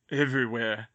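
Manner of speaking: ordinary delivery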